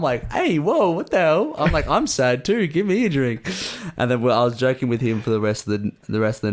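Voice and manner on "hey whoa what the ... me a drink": high, playful objection; mock protest. Friend angling for a free drink too, high mock-outraged plea. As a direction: protest as if cheated out of a treat.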